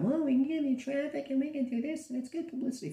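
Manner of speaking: high, mocking salesman voice; mocking high sales-pitch voice; mocking the media reps. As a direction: parody their sales pitch in a sing-song high voice. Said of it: "what do they offer me every single time".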